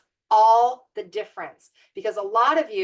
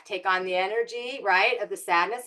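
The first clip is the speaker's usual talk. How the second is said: usual speaking voice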